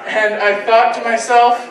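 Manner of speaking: ordinary talk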